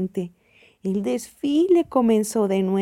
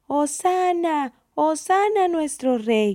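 crowd calling out; 6 semitones higher than usual. A narrator's reading. Children waving palms to Jesus, calling out. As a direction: cheer high without a full shout.

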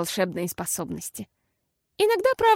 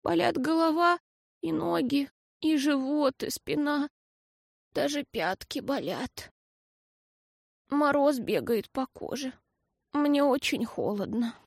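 put-on quiet, feeble sick-child voice; faint and shivery, listing aches; weak trembling voice; 4 semitones higher, 5 dB quieter than usual. A narrator's reading. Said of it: "дрожащим голосом прошептала".

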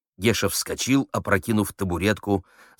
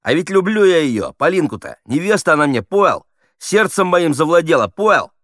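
high, loud, gabbling confession; hurried, swallowing words; 10 semitones higher, 8 dB louder than usual. A narrator's reading. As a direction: rush on, ever more worked up. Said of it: "Он торопился, проглатывая слова".

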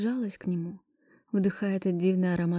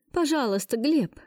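pleading; 6 semitones higher than usual. Acted. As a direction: beg him by name. Heroine begs ex for help.

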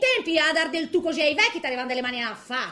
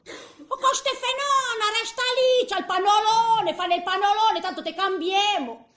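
high, loud, bossy nurse shouting at a patient; mock nurse yell; 6 semitones higher, 3 dB louder than usual. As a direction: bark orders at the old lady like a rushed nurse. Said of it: "m'hanno urlato".

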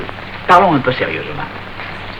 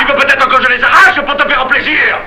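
high, heated sarcastic offer; furious sarcasm; 8 semitones higher than usual. Acted. Panisse retorts to Marius, furious sarcasm. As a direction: hurl the offer back at him. Quarrel peaks.

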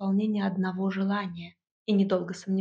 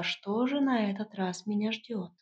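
ordinary delivery

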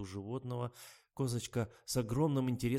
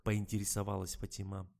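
usual speaking voice

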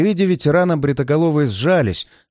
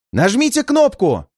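shout; 8 semitones higher than usual. A narrator's reading. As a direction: yell it across the carriage. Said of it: "крикнул Илюха".

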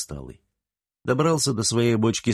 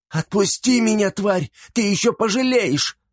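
much higher, louder furious threats; cursing threat; 13 semitones higher, 3 dB louder than usual. A narrator's reading. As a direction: spit the curses at her captor. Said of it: "Попробовала ругаться и угрожать".

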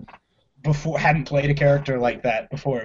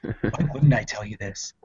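lower, slower deadpan answer; calm reply; 6 semitones lower, 6 dB quieter, slower than usual. Retold or imitated. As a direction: answer low and unbothered. Retold movie scene, calm reply.

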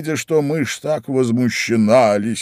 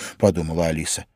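plain reading voice

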